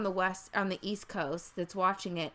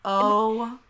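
choked up; 2 semitones higher, 11 dB louder than usual. Real, not acted. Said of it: "can barely even say it without crying".

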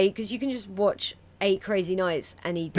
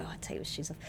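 much quieter, lower mumbled reply; compliant house guest; 5 semitones lower, 15 dB quieter, faster than usual. Retold or imitated. Guest answering the host's order about shoes, soft compliant mumble. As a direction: play a guest softly going along with it. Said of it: "So you're like".

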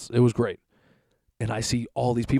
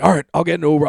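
resigned exclamation; 6 semitones higher, 9 dB louder, faster than usual. Retold or imitated. Reacting to dog escaping again.

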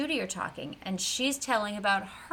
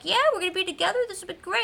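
put-on higher, bright childlike voice; eager kid; 9 semitones higher, much faster than usual. Gus happily telling Big Man they'll stay together, eager. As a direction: play the hopeful little boy. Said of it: "Gus is like".

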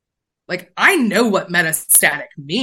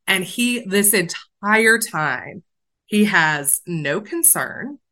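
ordinary delivery

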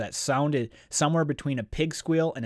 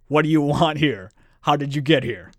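loud demand; 3 semitones higher, 8 dB louder than usual. A narrator's reading. Monster questions the stranger.

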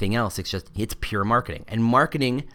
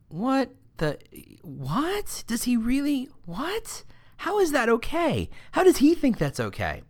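high-pitched mock incredulity; stunned disbelief; slower than usual. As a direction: squeak it out, baffled by what you just saw. Coworkers' stunned reaction, retold.

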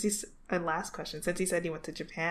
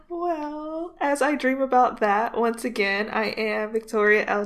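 bright, louder host sign-off; podcast outro; 3 semitones higher, 6 dB louder than usual. Acted. Host signing off the podcast, upbeat announcer read. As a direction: wrap up the show like a host.

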